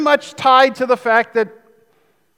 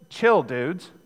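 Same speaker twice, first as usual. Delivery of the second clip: low, soft mock surfer drawl; laid-back surfer; 6 semitones lower, 4 dB quieter than usual. Preacher doing Jesus as a laid-back surfer dude, casual. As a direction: toss it off like a beach dude. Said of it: "the nineteen eighties translation".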